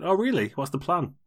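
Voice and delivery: high-pitched mock intrigued reply; put-on keen questioning; mock curiosity. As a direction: pitch it up like an audience playing along. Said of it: "you'll get people going".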